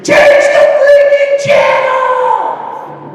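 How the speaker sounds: high, drawn-out screamed imitation; screaming wife. Wife screaming at the family over the commercial, imitated scream. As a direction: scream it like a panicked wife, stretching the words. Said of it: "my wife is screaming".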